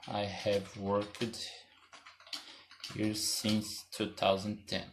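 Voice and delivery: slow, soft, every word drawn out; slow dictation. Shown as a demonstration. Teacher writing the example on the board, slow soft dictation. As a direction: read it out slowly as you write it.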